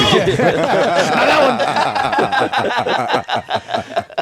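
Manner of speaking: high, laughing "not that one". Real, not acted. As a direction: say it while cracking up. Laughing at a wrong pick.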